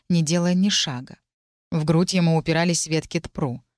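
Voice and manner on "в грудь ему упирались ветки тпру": ordinary delivery, nothing marked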